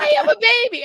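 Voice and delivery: put-on high, loud toddler voice; imitated little girl. Big sister thrilled to hold baby brother, high child voice. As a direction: pipe up like a proud two-year-old. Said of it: "she's holding him like".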